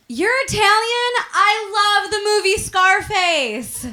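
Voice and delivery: high, loud, gushing fan; excited clueless guy. Retold or imitated. Guy reacting to an Italian, gushing. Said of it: "this guy was like".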